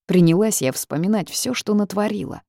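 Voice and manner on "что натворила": usual speaking voice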